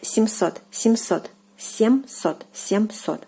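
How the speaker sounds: семьсот with a hard, unsoftened M, "sem-SOT"; семьсот, keep the M hard. Shown as a demonstration. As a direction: model семьсот with a plain hard M. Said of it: "So the M is kind of hard here".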